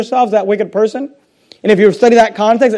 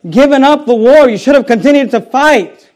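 louder, higher mock yell at the general; retold angry yell; 5 semitones higher, 5 dB louder than usual. Bitter woman yells at the general on the road, louder and higher. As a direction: voice her shouting that he never should have quit. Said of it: "begins to yell at him".

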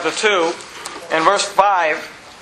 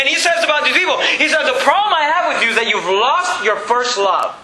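high-pitched, driving sermon delivery; fervent preaching; 7 semitones higher than usual. Real, not acted. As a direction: build the exhortation higher, then ease down at the end. Preaches with rising fervour.

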